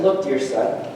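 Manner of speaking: low, measured, gentle fatherly reply; a hint of a tender, patient father; lightly voiced father. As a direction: reassure a son, slowing right down at the end. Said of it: "His father said to him".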